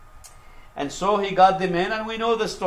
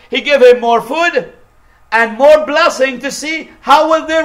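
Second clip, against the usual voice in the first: higher, louder pulpit storytelling; full-force preaching; 8 semitones higher, 7 dB louder than usual. Real, not acted. Preacher tells congregation of Joseph testing his brothers, full-force preaching. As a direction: drive the story out loud and high.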